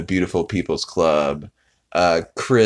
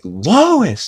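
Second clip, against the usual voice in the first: high, loud mock cry of the name; exaggerated startled yelp, retold; startled call; 12 semitones higher, 7 dB louder than usual. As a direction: blurt it out in a raised, much higher voice. Retelling Peter calling out startled.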